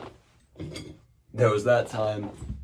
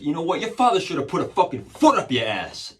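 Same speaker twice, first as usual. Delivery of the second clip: loud, high mock-officer tirade; angry cop's insult; 9 semitones higher, 9 dB louder than usual. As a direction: imitate a cop blowing up at a kid. Cop insults him in retold story.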